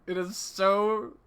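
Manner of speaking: slow words through laughter; laughing. Real, not acted. Streamer still laughing at goofy gear. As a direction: giggle it out slowly. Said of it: "fucking cackle".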